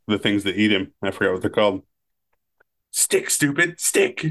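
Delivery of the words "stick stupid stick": high, exasperated coaxing of a dog; Nedry impression. Podcaster doing Nedry with the dinosaur, high coaxing whine. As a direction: scold the beast in a high whine.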